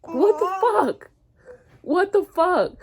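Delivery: high, slow, tearful outburst; choked-up disbelief at how they were treated; crying in disbelief. Real, not acted. Mother asking viewers how doctors can treat patients like this, crying out.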